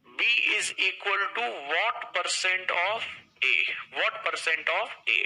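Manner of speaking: very slow, word by word; slow reading demo. Shown as a demonstration. Teacher reading the question aloud to students, very slow. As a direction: spell it out slowly for the class. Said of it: "आप इसे ऐसे पढ़िए".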